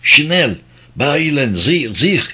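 lightly voiced German woman, urgent; a touch of a thin female voice; frail woman's voice. A narrator's reading. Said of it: "cılız bir kadın sesi konuşana kadar".

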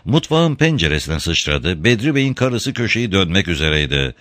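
ordinary delivery, nothing marked

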